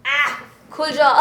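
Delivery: laughing while opening the box; giggling as it pops open. Real, not acted.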